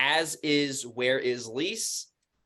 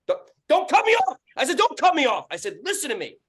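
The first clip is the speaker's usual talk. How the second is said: high, raised angry retelling; pissed off; 13 semitones higher, 6 dB louder than usual. Retold or imitated. Landlord retells tenant talking over him, pissed-off yell. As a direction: shout down someone who keeps interrupting. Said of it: "And now I'm fucking pissed".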